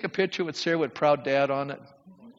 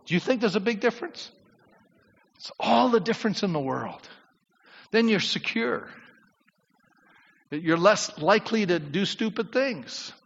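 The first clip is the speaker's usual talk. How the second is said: normal talking voice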